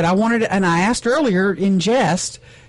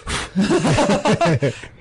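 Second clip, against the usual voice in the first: real laughter. Real, not acted. Host reacting to feather confession, laughing. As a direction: burst of laughing. Host breaks up during interview.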